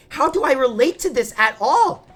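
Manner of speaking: high, loud mock protest; exasperated disbelief. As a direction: complain like a baffled student. Retold complaint about the book.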